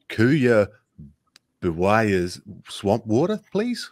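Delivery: "Kuya Biwaya's" sounded out slowly and awkwardly; mispronounced drink name. Acted. Tourist ordering "Kuya Biwaya's swamp water", clumsily mispronounced. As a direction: stumble slowly through "Kuya Biwaya's", lifting "please" high. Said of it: "mispronounces the, the, the, the word".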